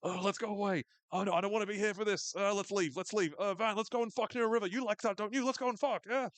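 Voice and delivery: hammed-up silly inner voice; last brain cell. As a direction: whine it like a horny, flustered mind. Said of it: "its mind is like".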